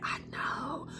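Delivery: breathy knowing whisper. Pretend. Reader whispering back to the viewer, knowing. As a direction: whisper it back, smug and soft. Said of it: "I'll be like".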